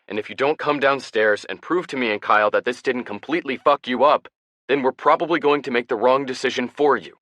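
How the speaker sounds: high, loud furious rant; angry yelling. A narrator's reading. Brother scolding Ben to get out of bed, angry shout. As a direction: let it all out at him, shouting. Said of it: "Ian never yells at me".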